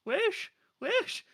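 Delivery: squeaky high stab at a Welsh accent; mangled Welsh accent, very high; failed Welsh accent. Shown as a demonstration. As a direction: push the Welsh accent way up and overdo it. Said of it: "Nope, sorry, Welsh people".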